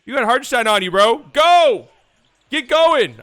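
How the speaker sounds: loud and high, yelling go; urgent shout to a teammate. Retold or imitated. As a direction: bark it like a point guard pushing the break. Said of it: "Kyrie right here telling Josh Green".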